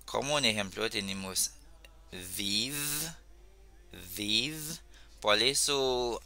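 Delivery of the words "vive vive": VIVE with buzzed V at start and end; V creola in VIVE. Shown as a demonstration. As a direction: draw out both Vs in VIVE. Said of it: "en criol se pronuncia v".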